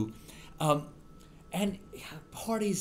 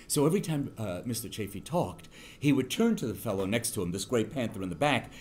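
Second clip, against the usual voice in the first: ordinary talk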